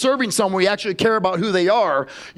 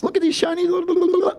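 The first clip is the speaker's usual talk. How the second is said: much higher, put-on sales pitch voice; mock pushy salesman; 11 semitones higher than usual. Mocking salespeople who love to run their mouths, high gushing pitch.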